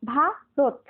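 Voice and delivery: ভারত in two beats, ভা, রত, with রত closed; one breath per syllable. Shown as a demonstration. Teacher shows what can be said at once, two syllables apart. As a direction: split ভারত as ভা - রত, keeping রত together. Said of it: "একবারে যতটুকু জায়গা উচ্চারণ করা যাবে".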